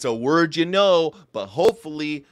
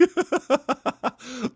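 laughing; 11 semitones higher than usual. Real, not acted. Laughs at the meme.

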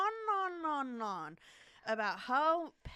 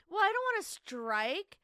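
raised, louder voice in mock outrage; acted indignant protest; 5 semitones higher, 7 dB louder than usual. Prankster protesting to stranger about her pay, mock indignant. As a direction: play the affronted coworker.

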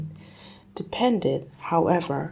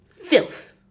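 disgusted father; 13 semitones higher, 4 dB louder than usual. Acted. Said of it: "Mister Wormwood said".

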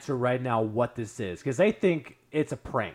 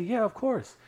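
higher-pitched, over-polite welcome; lilting, hospitable put-on voice; mock gracious hosts; 9 semitones higher than usual. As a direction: imitate relatives graciously offering their home. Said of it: "because that's how they talk".

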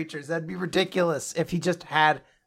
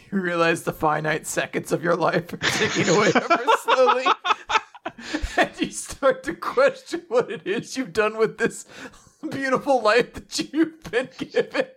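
helpless laughter breaking up the sentence; laughing through words; 4 semitones higher than usual. Real, not acted. Teasing Nick about a life wasted reading, laughing. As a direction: talk through mounting giggles, pitch climbing.

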